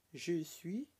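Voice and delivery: ordinary talk, nothing marked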